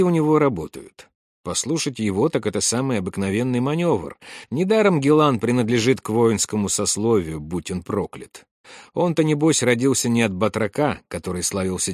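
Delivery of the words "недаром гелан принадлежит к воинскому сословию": ordinary delivery, nothing marked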